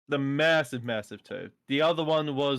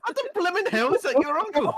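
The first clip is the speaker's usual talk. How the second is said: amused outrage; 4 dB louder, faster than usual. Real, not acted. Mock outrage at uncle claim.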